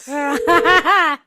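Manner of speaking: real laughter; amused laugh. Real, not acted. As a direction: burst out laughing at a friend's claim. Laughs at claim of never lying.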